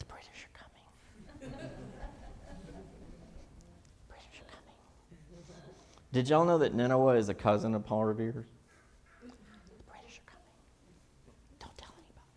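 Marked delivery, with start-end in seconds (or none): 0.0-0.8 soft unvoiced whisper; whispered warning. Teacher imitating the girl's whisper to the class, hushed. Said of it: "It was just like that, huh?". 4.1-4.8 soft unvoiced whisper; whispered warning. Teacher imitating the girl's whisper to the class, hushed. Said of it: "It was just like that, huh?". 9.2-12.3 secretive whisper with a joke; mock whisper. Teacher mocking the whisper for the class, whispered. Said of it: "What kind of voice did he say it with?".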